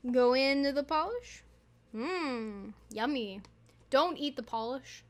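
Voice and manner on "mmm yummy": dry, mock relish on yummy; sarcastic. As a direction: say it like it's tasty, not meaning it. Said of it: "I say yummy sarcastically".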